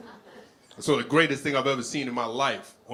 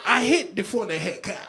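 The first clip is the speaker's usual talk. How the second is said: loud, raised mock Haitian accent; imitating Haitian lady; 9 semitones higher, 7 dB louder than usual. Comedian confesses to the cops as the lady, Haitian-accented pleading. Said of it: "I was talking like that bitch".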